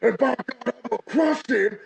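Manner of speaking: shouting wrestler promo rant; barbarian. Acted. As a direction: bellow it like a brawler.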